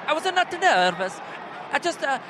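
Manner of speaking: comic halting Italian; Italian accent. Acted. Podcaster quotes Pirlo on nerves, Italian accent. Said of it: "listen to [name]'s Italian impression".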